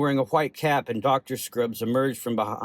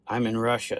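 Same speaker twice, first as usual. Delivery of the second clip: plain reading voice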